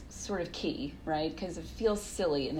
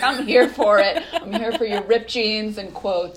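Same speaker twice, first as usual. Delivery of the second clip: giddy, laughing, high and loud; laughing excited; 4 semitones higher, 8 dB louder than usual. Retold or imitated. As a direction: gush with delight. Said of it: "And I was like".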